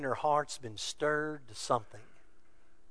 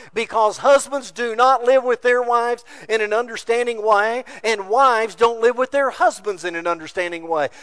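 louder, rising sermon cadence; full preaching; 6 semitones higher, 8 dB louder than usual. Real, not acted. Urging the congregation's men, full force preaching.

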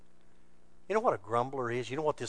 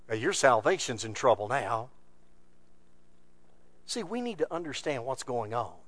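ordinary talk, nothing marked

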